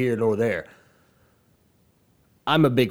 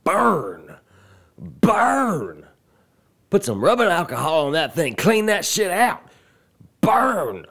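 loud, high gleeful taunting; gleeful burn; 8 semitones higher, 5 dB louder than usual. Real, not acted. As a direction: crow it like a roast. Gloating over the burn tweet.

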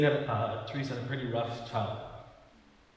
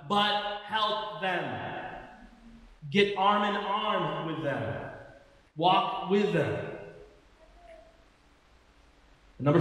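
projected, emphatic sermon delivery; preaching; 6 semitones higher, slower than usual. Real, not acted. Pastor urging the church to help one another, preaching. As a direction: preach it with drawn-out key words.

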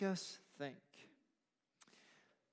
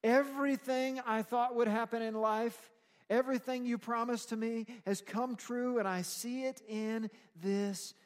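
high, loud preacher speaking as a man fulfilled; emphatic declaration to God; 7 semitones higher, 7 dB louder than usual. Acted. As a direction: proclaim it upward, easing at the end. Preacher voices Simeon's emphatic words to God.

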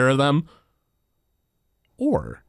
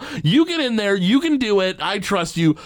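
excited insistence; 7 semitones higher than usual. Retold or imitated. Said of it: "Herbert Powell's like".

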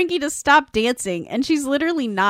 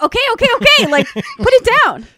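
high, loud urging to stop; retold panicky intervention; alarmed protest; 11 semitones higher, 9 dB louder, much faster than usual. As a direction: cut them off in mock alarm. Retold alarm at friend gulping tea.

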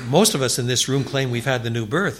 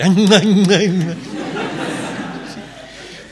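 real laughter; 7 semitones higher, 4 dB louder than usual. Real, not acted. Laughing at own joke.

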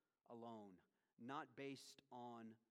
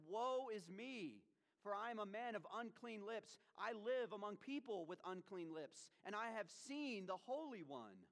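crying out in woe; 7 semitones higher, 4 dB louder than usual. Retold or imitated. Isaiah exclaims before the holiness of God, crying out. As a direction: cry it out like a stricken prophet. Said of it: "He cried out, he said".

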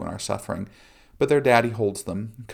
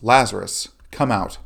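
louder, commanding call without a full yell; restrained shout; 7 dB louder than usual. A narrator's reading. Jesus calls dead Lazarus from the grave, restrained shout. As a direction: call it out firmly, as a narrator would. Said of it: "and then he shouts".

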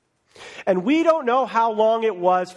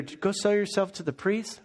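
softer, passing the words down the line; passed-along murmur; 6 dB quieter than usual. Retold or imitated. Leper passing the message to another, softly. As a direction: relay it quietly to the next leper.

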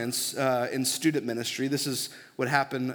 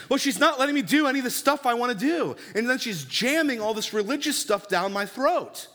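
put-on indignant teen; 9 semitones higher than usual. Teen complains about mother.

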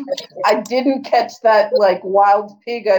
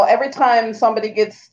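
ordinary delivery

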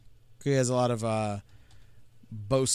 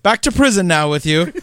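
a louder, playful order to the prisoners; mock command; 4 semitones higher, 10 dB louder than usual. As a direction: bark it like a pompous jailer, in jest. Voicing a line at Franklin's carriers.

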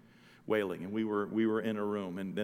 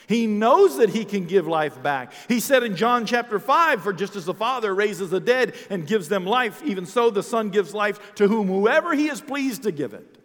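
high, loud scripture proclamation; full-force preaching; 11 semitones higher, 12 dB louder than usual. Real, not acted. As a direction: proclaim it to the whole congregation.

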